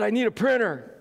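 high-pitched pleading outburst; mock urgent plea. Preacher acting out asking neighbors for a printer, mock urgent plea. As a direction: blurt it out in mock desperation.

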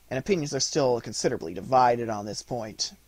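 plain reading voice

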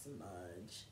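Smudge very low and soft; sound matching meaning. Shown as a demonstration. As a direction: drop Smudge right down, barely voiced. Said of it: "sounds were perfectly suited for their meaning".

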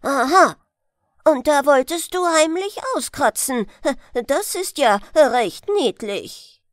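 loud, mocking grandfather; catching him out. Grandfather catching the grandson sneaking off, loud irony. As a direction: catch the runaway with an ironic aha.

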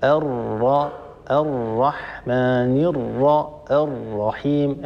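slow recitation. Shown as a demonstration. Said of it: "لما نقول مثلًا".

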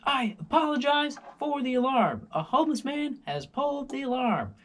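high, loud apologetic announcement; mock intercom guard. Security guard apologises to residents on intercom, high loud announcer voice. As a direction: play the security guard over the speakers, overly formal. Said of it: "the guy comes back and says".

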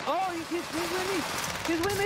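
high panicked yelp; frantic high cry for help; panicked yelling. Real, not acted. Panicked callout in game.

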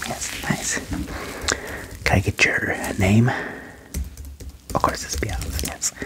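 usual speaking voice

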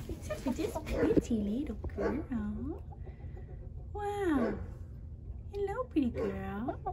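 slow, quiet pet talk to a hen; tender, drawn out; soft cooing. Real, not acted. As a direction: coo lovingly at her.

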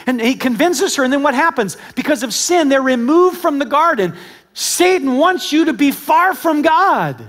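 high, loud, driving sermon delivery; full-force preaching. Acted. Preacher warning the congregation about Satan, full-force preaching.

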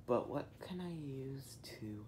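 hushed scheming whisper. Acted. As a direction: whisper it to yourself. Sneaking, plotting alone.

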